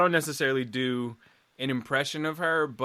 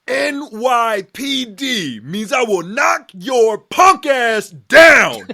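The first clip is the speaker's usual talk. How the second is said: loud, high, drawn-out movie-cop shout; 9 semitones higher, 13 dB louder, much slower than usual. Acted. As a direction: slowly holler each word as a cocky threat. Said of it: "[name] was another early impression".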